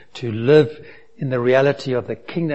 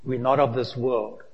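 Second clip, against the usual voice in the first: normal talking voice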